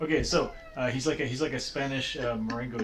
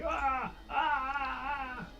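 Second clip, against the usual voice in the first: high, thin screams at restrained volume; repeated mock monster screams, held back; screaming; 11 semitones higher, 5 dB quieter, much slower than usual. Game master acts out screaming ghast, restrained high screams. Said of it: "This ghast is just screaming".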